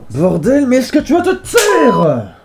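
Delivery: loud, slow, annoyed shout; angry yell. Acted. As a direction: yell at him to shut up. Host yells at the sidekick.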